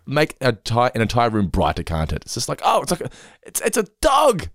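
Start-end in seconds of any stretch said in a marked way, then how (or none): 2.6-4.5 high-pitched gush over a dog; delighted dog-lover. Acted. Entire room brightened by a dog, acted delight. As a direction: act out a squeal of delight, pitch way up.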